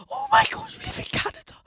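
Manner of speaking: a sudden very high, loud squeal of excitement; excited squeal. Real, not acted. Streamer squeals at a viewer living in Canada, excited. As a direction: shriek with envy and delight.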